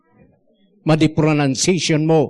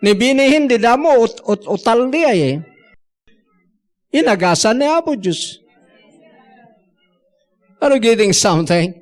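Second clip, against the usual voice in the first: ordinary delivery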